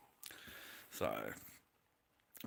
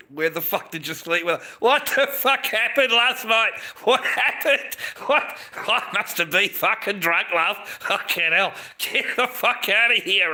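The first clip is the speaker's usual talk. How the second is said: high, loud mock freak-out; panicked shouting; 10 semitones higher, 9 dB louder, slower than usual. Waking beside the wrong person after a drunken night, panicked shouting. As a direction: shout it in hammed-up horror.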